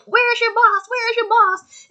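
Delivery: high, loud mock demand; mock hero demand. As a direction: bark it in a silly high voice. Imitating hero demanding repeatedly.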